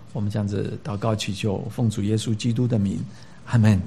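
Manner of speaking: prayer. Real, not acted. Pastor praying with the congregation before the sermon, soft earnest prayer. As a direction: pray quietly and humbly before the word.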